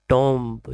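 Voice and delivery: टॉम्ब with an "o" as in Tom, b sounded; common mispronunciation. Shown as a demonstration. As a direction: say टॉम्ब wrongly as "tomb" with audible b. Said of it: "इसे अक्सर pronounce करते हैं".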